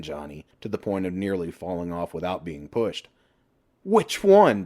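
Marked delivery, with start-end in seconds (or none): 3.8-4.6 high, loud, cheeky question; smirking reply to the man below; smiling quip. A narrator's reading. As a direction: toss it back with a grin.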